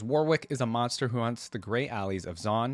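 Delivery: normal talking voice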